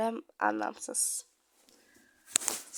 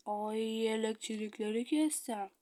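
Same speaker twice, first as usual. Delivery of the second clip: normal talking voice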